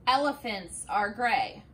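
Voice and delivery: ordinary delivery, nothing marked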